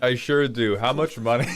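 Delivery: usual speaking voice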